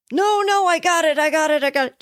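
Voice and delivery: shrill-high insistence on going it alone; frantic refusal. Pretend. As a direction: wave the helper off in a panic. Drowning person refusing help.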